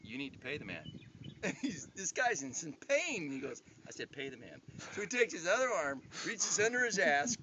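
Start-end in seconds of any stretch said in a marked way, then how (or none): 0.0-0.8 quiet menace. Retold or imitated. Dad voiced calmly commanding beaten man. 3.9-4.6 quiet menace. Retold or imitated. Dad repeats order to beaten man.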